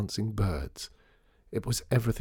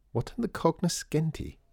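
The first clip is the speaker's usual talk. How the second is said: put-on high-pitched child's question; higher, innocent questioning; curious little girl; 8 semitones higher than usual. A narrator's reading. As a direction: ask as a puzzled young girl. Child asks a puzzled question.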